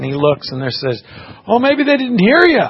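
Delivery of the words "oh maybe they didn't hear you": high, loud mocking taunt; making fun. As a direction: tease him in an exaggerated raised voice. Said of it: "they're making fun".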